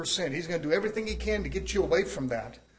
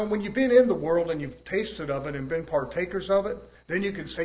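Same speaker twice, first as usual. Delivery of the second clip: ordinary delivery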